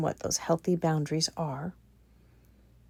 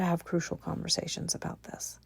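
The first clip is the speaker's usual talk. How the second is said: whisper; 3 semitones lower, 10 dB quieter than usual. Real, not acted. Aside drops to whisper.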